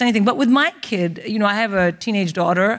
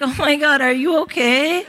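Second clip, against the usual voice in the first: alarmed American roommate; 5 semitones higher than usual. Acted.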